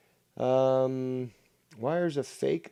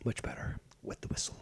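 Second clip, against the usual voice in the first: softer, breathy aside to himself; whisper; 10 dB quieter, faster than usual. Real, not acted. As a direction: murmur it after the sip. Aside after taking a drink.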